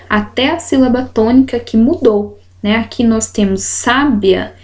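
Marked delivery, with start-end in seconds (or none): none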